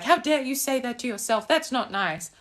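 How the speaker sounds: high, prissy pearl-clutching voice; mock the people who find it too harsh; a lightly voiced shocked scold; offended critic. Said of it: "Some people will be like".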